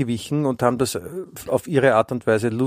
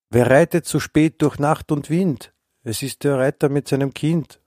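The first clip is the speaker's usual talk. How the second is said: slow, higher dramatic recital; poem recitation; 4 semitones higher than usual. Pretend. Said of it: "Halt einmal kurz einen Monolog".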